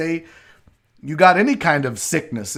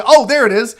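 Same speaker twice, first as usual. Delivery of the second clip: ordinary delivery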